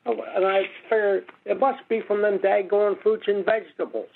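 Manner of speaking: ordinary talk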